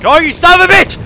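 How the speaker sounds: screamed high and loud; full-throated angry yell; furious yell. Real, not acted. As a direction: scream it at the screen. Yelling at game character.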